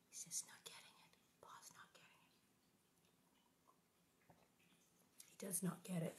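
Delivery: soft unvoiced whisper; whispered aside. Real, not acted. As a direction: confide it to the listener. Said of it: "whispered aside about Pa".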